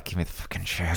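ordinary delivery

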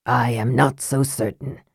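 low, soft, doubting mage; lightly voiced low, measured sceptic; Raistlin. A narrator's reading.

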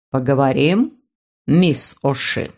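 put-on low, slow elderly gentleman; a sly old notary musing. Notary proposes talk.

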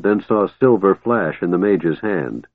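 usual speaking voice

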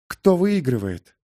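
plain reading voice

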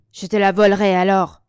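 loud, blunt threat; brutal. A narrator's reading. Marcine threatening to steal his friend's dog, brutally. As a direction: throw it out brutally and forcefully. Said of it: "dit brutalement Marcine".

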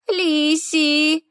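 put-on high, small child; little girl voice. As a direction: answer like a shy little girl.

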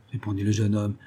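plain reading voice

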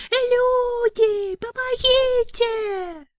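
very high, drawn-out thin wailing cry. A narrator's reading. The mother wailing about murder, very high and drawn out. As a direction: wail like a village mother. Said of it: "заголосила Вера Семёновна".

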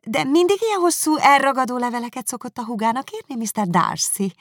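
magasabb, hangosabb, hízelgő úrihölgy; mézesmázos kérdés, feljebb emelve; hízelgő Miss Bingley. Acted. Miss Bingley flatters Darcy. (magasabb hangon, hízelegve)

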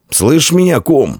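high-pitched hail to a soldier; calling out. A narrator's reading. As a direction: call the old man over. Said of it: "окликнул он старика".

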